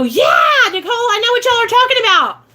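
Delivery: high, fast excited cry to her name; excited shout. Pretend. Viewers excitedly shouting back to her. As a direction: burst out like a thrilled fan.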